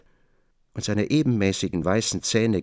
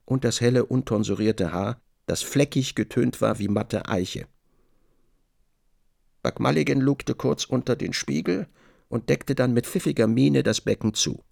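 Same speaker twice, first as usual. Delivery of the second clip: ordinary talk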